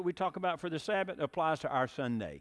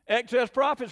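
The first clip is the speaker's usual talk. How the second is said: higher, louder outraged cry; cried out; 8 semitones higher, 9 dB louder than usual. Acted. Cow cries out at the hen, higher loud outrage. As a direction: protest the hen's bread. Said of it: "cried the cow".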